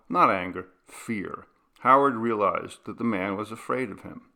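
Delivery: ordinary delivery, nothing marked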